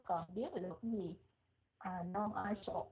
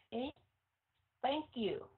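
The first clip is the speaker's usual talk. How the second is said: ordinary talk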